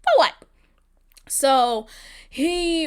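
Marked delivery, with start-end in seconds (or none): 0.0-0.3 high, loud "For what?" shouted out; "For what?" thrown out as a high exasperated shout. Acted. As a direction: yell "For what?" in disbelief. Rising frustration, repeated question.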